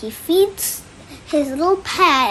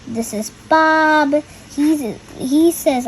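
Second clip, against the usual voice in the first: plain reading voice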